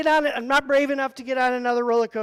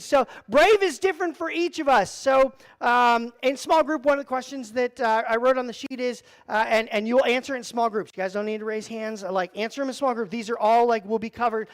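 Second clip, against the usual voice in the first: ordinary talk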